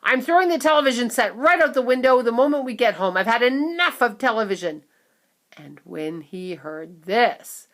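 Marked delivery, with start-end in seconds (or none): 0.0-4.9 loud, fast furious rant; fed-up father; angry. A narrator's reading. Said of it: "shouted Mister Teavee".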